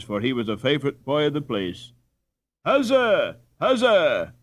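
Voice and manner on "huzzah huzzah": high, loud triumphant cheer. Acted. Crowd cheering Peter, loud and high. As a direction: hurrah for the champion.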